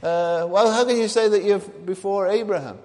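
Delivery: high, loud incredulous question; challenging objectors. Acted. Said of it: "they say to him".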